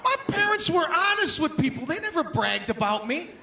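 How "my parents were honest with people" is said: comic rant. Real, not acted. Ranting at bragging parents.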